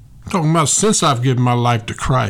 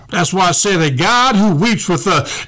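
ordinary talk, nothing marked

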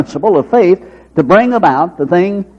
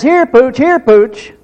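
raised-pitch pet call; acted dog call, pitched up; 7 semitones higher, slower than usual. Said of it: "he calls until Pooch comes".

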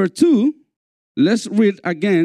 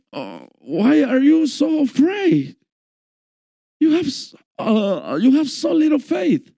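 groggy just-woken Jesus; 6 semitones higher than usual. Shown as a demonstration. Preacher acting out Jesus waking in the boat, groggy and high. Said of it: "Maybe he said it this way".

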